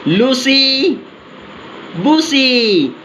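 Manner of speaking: लूसी then बूसी, each stretched slowly with a pause between; drawn-out mnemonic. Shown as a demonstration. Teacher showing students the mnemonic, slow and drawn out. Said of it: "हमें इसको पढ़ना है लूसी बूसी".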